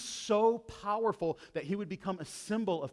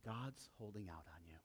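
hushed, much softer tempting murmur; serpent whispering; 8 semitones lower, 16 dB quieter than usual. Acted. Preacher voicing the serpent tempting Eve, hushed. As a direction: lean in and murmur it like a secret. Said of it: "See what he's whispering here?".

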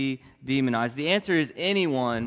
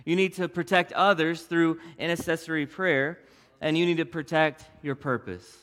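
normal talking voice

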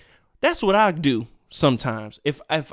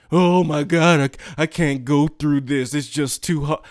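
mock whining adult. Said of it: "grown ass adults".

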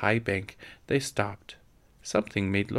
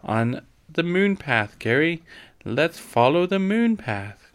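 high, drawn-out eager call; excited cry; 7 semitones higher, 4 dB louder than usual. A narrator's reading. Laura calling Carrie to follow the moon path, excited cry. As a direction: cry out slowly in a higher voice. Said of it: "cried Laura".

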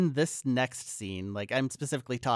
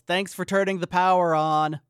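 louder, higher called-out thanks; raised-voice shout of thanks; yelling; 6 semitones higher, 7 dB louder than usual. Retold or imitated. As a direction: yell it through an open doorway. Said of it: "opens the door and yells".

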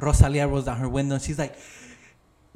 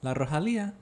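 Spanish accent. Shown as a demonstration. Explaining to her friend in a Spanish accent. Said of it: "I like to, like, do that accent".

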